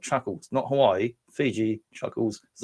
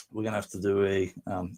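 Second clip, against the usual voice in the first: ordinary talk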